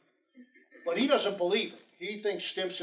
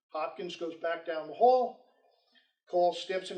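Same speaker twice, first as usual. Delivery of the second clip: ordinary delivery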